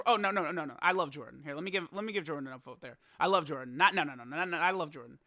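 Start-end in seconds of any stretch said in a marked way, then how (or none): none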